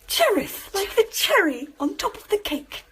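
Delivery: usual speaking voice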